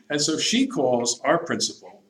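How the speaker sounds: ordinary talk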